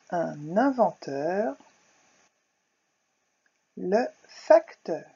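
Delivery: each word said slowly and clearly; slow dictation. Shown as a demonstration. Teacher to pupils, slow clear dictation.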